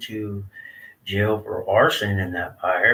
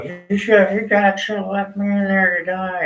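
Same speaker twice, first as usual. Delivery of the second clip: higher, accusing mom voice, imitated; bitter mom; 11 semitones higher, 5 dB louder than usual. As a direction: mom blaming the dad for the fire. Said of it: "my mom's all".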